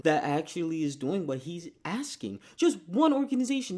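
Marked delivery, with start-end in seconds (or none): 2.6-3.8 incredulous outburst. Real, not acted. Repeats the debater's challenge, incredulous.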